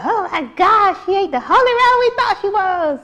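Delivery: high-pitched, mock-scandalised voice; mock shocked gossip. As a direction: play the shocked churchgoer, way up high. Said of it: "and then y'all be like".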